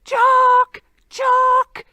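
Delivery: loud, high call across the hills; bellowing a name across the glen. Acted. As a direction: shout his name from afar. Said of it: "So he shouts out".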